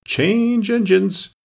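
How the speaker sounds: very high, very slow strained call; hoarse voice. Acted. A hidden passenger croaks out, high and slow. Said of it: "a hoarse voice spoke next".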